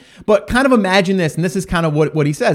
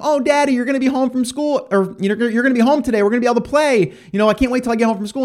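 put-on high, eager kid voice; a lightly voiced happy child; excited child; 6 semitones higher, faster than usual. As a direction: bounce like a kid who can't wait to play.

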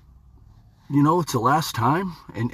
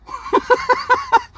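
laugh. Real, not acted. Laughs after hitting the note.

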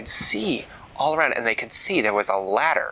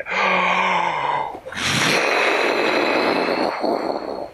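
fiery gasp; 6 semitones higher than usual. A narrator's reading. Dragon breathes fire on trapdoor.

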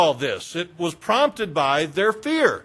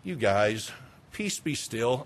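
soft, low, casual request; understated, quietly offhand command; very simple tone; 8 semitones lower, 9 dB quieter, slower than usual. Acted. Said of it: "in a very simple tone like".

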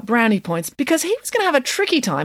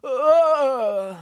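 long groggy moan, raised in pitch; 8 semitones higher than usual. A narrator's reading. Mister Green waking from a knock, moaning. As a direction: groan as you come round. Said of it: "moaned Mister Green".

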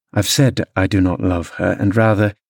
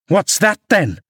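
high, challenging comeback; indignant retort, pitched well up; sharp retort; 9 semitones higher than usual. A narrator's reading. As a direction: throw the question back as a challenge. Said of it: "I retorted".

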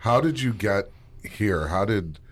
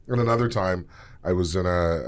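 ordinary talk

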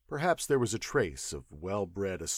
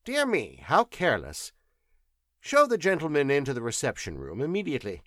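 higher, louder, fast command; brisk professor; 5 semitones higher, 5 dB louder, faster than usual. Acted. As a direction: exclaim then order briskly. Professor orders servant.

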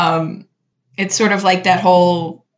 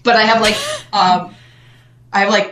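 laughing; 4 semitones higher than usual. Retold or imitated. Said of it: "and I'm like".

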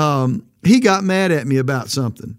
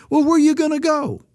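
high challenge; 9 semitones higher, faster than usual. Acted. Preacher challenging quitter, voice high.

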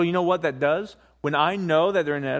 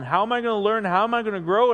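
usual speaking voice

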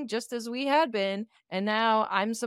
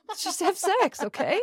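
playful demand; 9 semitones higher than usual. Real, not acted.

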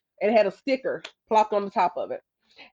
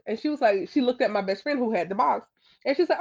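ordinary delivery, nothing marked

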